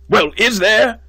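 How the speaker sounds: high, loud, sharp insistence; impatient demand. Acted. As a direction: push him for an answer. Dean presses hesitant student.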